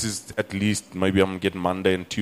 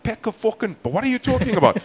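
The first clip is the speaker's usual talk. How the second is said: high-pitched, incredulous outburst; baffled protest, voice shooting up; hammed-up shock at the doctor; acted disbelief; 13 semitones higher, 3 dB louder than usual.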